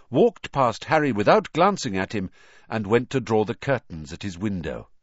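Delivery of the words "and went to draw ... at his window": ordinary delivery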